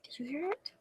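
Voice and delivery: very soft, barely audible asking; secretive storybook hush; hushed dragon question. Acted. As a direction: ask in the tiniest hushed voice.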